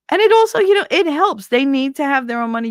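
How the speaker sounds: high, loud insistence; heated advice; emphatic. Real, not acted. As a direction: push the point hard.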